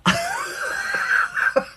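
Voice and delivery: high squealing burst of laughter; real high-pitched shriek of laughter. Real, not acted. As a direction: crack up at the punchline. Punchline laugh.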